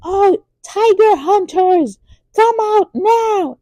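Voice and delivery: high, slow, sing-song summons; summoning call. Retold or imitated. Inspector General summoning his hidden hunters, high slow call. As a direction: call the hidden men out with confidence.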